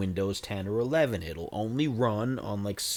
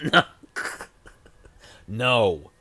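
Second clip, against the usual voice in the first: loud laughing no; 6 dB louder than usual. Real, not acted. Firm refusal with a laugh.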